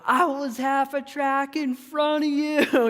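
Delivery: high, drawn-out taunt; mock gloating. Race winner rubs it in to the runner behind, mock gloating. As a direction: crow it slowly in a high mocking voice.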